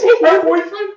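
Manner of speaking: high, loud whiny mock boyfriend. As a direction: squeal it like a jealous boyfriend teasing. Mocking jealous boyfriend at Disney line.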